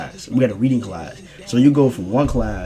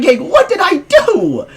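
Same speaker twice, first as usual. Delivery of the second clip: high, slow, protesting whine; hammed-up innocent protest; mock disbelief; 5 dB louder, slower than usual. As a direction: act wronged and baffled, pitched up. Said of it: "I was like".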